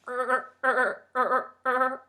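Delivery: rhythmic mock panting grunts; panting airplane. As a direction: puff like a tired machine. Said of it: "Can't you hear it panting".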